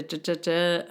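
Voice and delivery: normal talking voice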